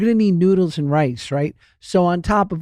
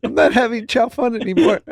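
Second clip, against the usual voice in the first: laughing; 7 semitones higher than usual. Acted. Teasing friend, laughing.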